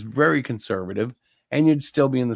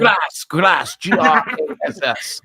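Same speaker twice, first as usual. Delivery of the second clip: louder, higher insistence in mock European accent; 4 semitones higher, 4 dB louder, slower than usual. Correcting a listener who heard crack, mock European accent. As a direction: spell it out loudly in a put-on European accent. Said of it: "that is my European accent".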